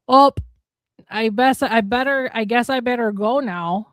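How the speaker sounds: broad Minnesota accent, Midwest politeness. Shown as a demonstration. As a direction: drawl the goodbye like a Minnesotan. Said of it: "Let my actual M-, Minnesota accent come through".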